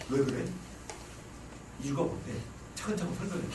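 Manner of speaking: low, subdued questioning; understated, unemotional inquiry; rational husband. Acted. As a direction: play the matter-of-fact husband asking for reasons. Voices a rational husband questioning his wife.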